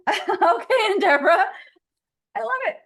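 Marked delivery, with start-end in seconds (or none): 0.0-1.4 excited welcome. Real, not acted. Greeting attendees joining the call.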